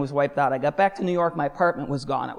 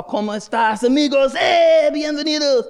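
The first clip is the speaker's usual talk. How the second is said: loud, high Spanish hailing; calling out welcome; 10 semitones higher, 8 dB louder than usual. Retold or imitated. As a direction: holler a festive welcome to the crowd. Calling out to carnival crowd.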